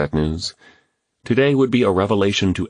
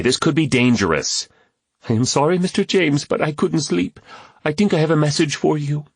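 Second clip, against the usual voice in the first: put-on higher young boy, apologetic; boy's voice; 10 semitones higher than usual. A narrator's reading. Kwame explaining to James, apologetic boy's voice. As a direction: speak as a small sorry boy.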